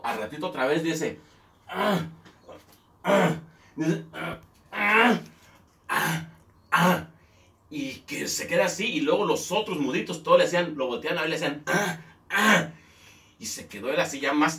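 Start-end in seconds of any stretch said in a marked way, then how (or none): 1.7-3.4 loud, higher mute grunts; mute grunting. Retold or imitated. Mute customer grunting at the bartender again. 4.1-7.1 higher, louder wordless grunts; mute grunting. Retold or imitated. Mute customer grunting a tune at the bartender. 11.7-12.7 higher, louder mute grunts; mute grunting. Retold or imitated. The other mutes grunting back at the bartender.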